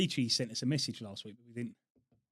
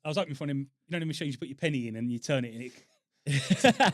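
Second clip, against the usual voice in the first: laughing; 2 semitones higher, 7 dB louder than usual. Real, not acted. Joking about the gift shop.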